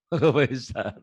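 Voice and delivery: amused repeat of the student's slip; words broken up by a chuckle; laughing echo. Retold or imitated. Teacher laughs repeating student's garbled term.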